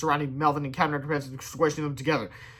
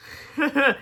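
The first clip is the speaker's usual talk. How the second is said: high, shaky nervous giggle; nervous laugh; 9 semitones higher than usual. A narrator's reading. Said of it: "Laughed Melvin nervously".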